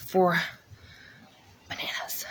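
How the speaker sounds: breathy whisper of the word. Real, not acted. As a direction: whisper it like a sad secret. Whispered sadly after "ooh.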